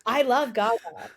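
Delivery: [name]. Retold or imitated. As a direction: quote the rant with rising disbelief. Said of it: "have you ever seen the [name] interview".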